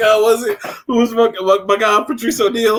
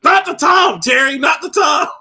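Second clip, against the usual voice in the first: exasperated shout; 7 semitones higher than usual. Pretend. Friend yelling at Terry that it's not the time. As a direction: snap at Terry to stop. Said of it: "it's like".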